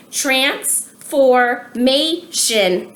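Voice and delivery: very slow, each syllable pulled apart; slowly by syllables. Shown as a demonstration. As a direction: spell out transformation in four beats. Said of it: "four syllables".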